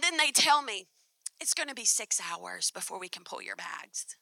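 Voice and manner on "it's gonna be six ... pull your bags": whispered airline bad news; whispered staff warning; hushed bad news. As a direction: breathe it out like a secret.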